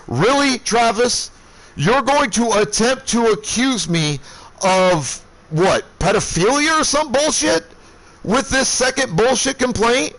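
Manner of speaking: retold angry confrontation. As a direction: throw it back in his face, outraged. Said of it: "I am livid".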